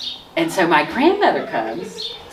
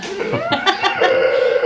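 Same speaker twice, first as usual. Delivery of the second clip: laughter. Real, not acted. Smoked last cigarette on airplane.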